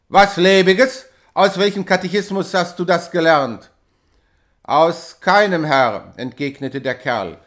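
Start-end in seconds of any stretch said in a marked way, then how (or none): 0.1-3.7 loud indignant question; outraged retort. A narrator's reading. Hauke outraged at superstition. 4.6-6.1 loud, slow cheeky answer; mock the master; insolent reply. A narrator's reading.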